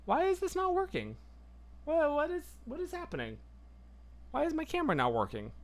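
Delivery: high, puzzled frustration; confused at broken camera; baffled. Real, not acted. Camera not working.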